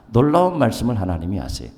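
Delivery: ordinary talk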